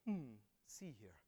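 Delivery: soft, slow, casual hmm see here; a lazy muttered see here; offhand murmur. Shown as a demonstration. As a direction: toss it off as if it hardly matters. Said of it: "But it's not like this".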